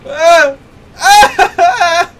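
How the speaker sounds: high startled panic cry; panicked yelp. Real, not acted. Panicked in fight.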